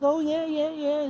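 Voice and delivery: high, hammed-up "oh yeah yeah"; mock recognition. [name] pretends to recognise him.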